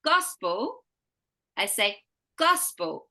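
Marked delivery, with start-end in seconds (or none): 0.0-0.8 gospel with GOS raised and stronger; stress on first syllable. Shown as a demonstration. Teacher giving a stress example, gospel stressed on GOS. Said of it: "Our voice goes higher in pitch and stronger". 2.4-3.1 gospel with GOS punched, PEL falling away; stress on first syllable. Shown as a demonstration. Teacher repeating the stress example, gospel stressed on GOS. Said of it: "stronger on the stressed syllable".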